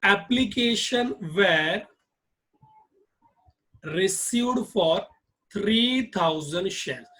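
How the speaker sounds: very slow, word by word dictation. Shown as a demonstration. Teacher dictating to students, slow and deliberate. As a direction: spell it out slowly for notes. Dictating journal entry slowly.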